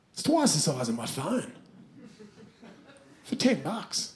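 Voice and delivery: ordinary talk, nothing marked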